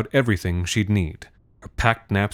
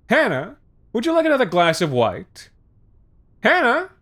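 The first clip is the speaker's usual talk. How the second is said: calling to another room. A narrator's reading. Father yelling to another room.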